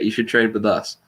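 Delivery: ordinary talk, nothing marked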